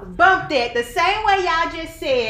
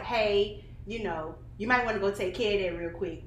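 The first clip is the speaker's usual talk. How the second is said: gentle hint; 5 semitones lower, 6 dB quieter than usual. Retold or imitated. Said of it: "I'm gonna be like".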